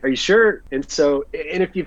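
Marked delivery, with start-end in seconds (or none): 0.0-0.6 surprised, unsure. Retold or imitated. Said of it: "I was like".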